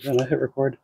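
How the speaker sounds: ordinary delivery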